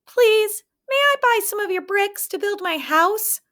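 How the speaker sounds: eager little pig, high voice; polite little pig. A narrator's reading. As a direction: third pig asks the builder for bricks, high. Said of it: "he asked".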